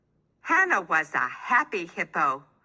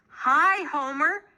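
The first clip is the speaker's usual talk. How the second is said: excited greeting; 9 semitones higher than usual. A narrator's reading. Happy hippo greets Homer.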